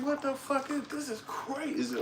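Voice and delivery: very high, thin disbelief; acted shock. City visitor shocked by the country, high mock astonishment. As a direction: squeal it softly in mock astonishment. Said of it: "that shit is a shock".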